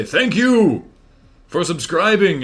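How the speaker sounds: bright, high, loud thanks; cheery thank you. Shown as a demonstration. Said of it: "mine has just been to say".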